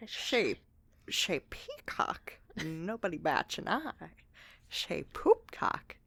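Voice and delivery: low-key, hushed villain impression, half whispered; badly faked brooding villain, soft and breath-heavy; Joker. Co-host doing a silly impression for her friends, hushed villain. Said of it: "I'm doing my Joker impression".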